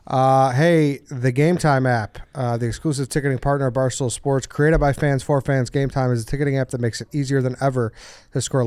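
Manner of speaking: upbeat sponsor read. Acted. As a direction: read it like a podcast ad. Reads the sponsor ad.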